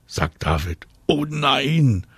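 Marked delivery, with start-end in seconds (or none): 1.1-2.1 drawn-out, higher groan of protest; long higher-pitched groan. A narrator's reading. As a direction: moan it in dismay, stretched out. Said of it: "stöhnt Anna".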